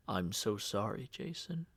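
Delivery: low soft murmur. A narrator's reading. Uncle Jed consoling grieving Jason, low soft murmur. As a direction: murmur it gently, barely above breath. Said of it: "he murmured".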